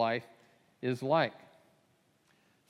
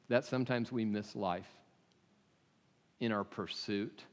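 ordinary talk

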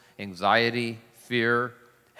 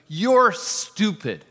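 slow, higher, louder insult; venting anger; 6 semitones higher, 5 dB louder, much slower than usual. Retold or imitated. Old man venting at the shopper, slow loud insult. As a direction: spit the insult out slowly and loudly. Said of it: "He's giving full vent to his anger".

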